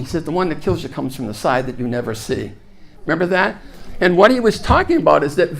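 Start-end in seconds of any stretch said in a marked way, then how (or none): 0.2-2.5 put-on low, soft Australian accent. Movie expert warning scientists of a side attack, Australian accent. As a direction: drop it low and quiet, sounding Australian. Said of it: "I love to do the Australian accent".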